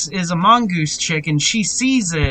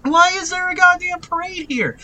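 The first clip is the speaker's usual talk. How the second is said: mock high-pitched frantic woman's yell; hammed-up high female voice, held up high; 12 semitones higher than usual. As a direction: imitate a harried woman in a squealed falsetto. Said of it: "this woman is yelling".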